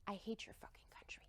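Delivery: hushed, whispered curse; whispered translation of an angry line. Retold or imitated. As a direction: breathe the insult as a quiet aside.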